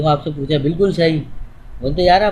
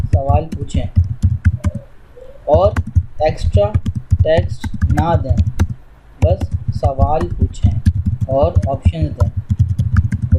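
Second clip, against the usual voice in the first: slow dictation; much slower than usual. Shown as a demonstration. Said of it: "अब ऐसे नहीं बोलो".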